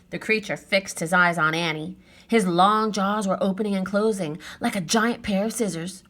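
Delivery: usual speaking voice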